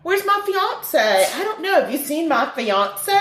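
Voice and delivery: high, mock-posh fretting; a flustered Brit looking for his fiance; mock British fuss. Host riffing on Danny's fiance, mock-posh fretting.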